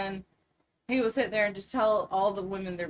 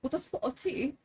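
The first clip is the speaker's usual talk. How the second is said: higher-pitched attempt at British; playful mock British; British accent; 5 semitones higher, 4 dB quieter than usual. Shown as a demonstration. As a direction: try out a limited British accent. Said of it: "the only British I can do".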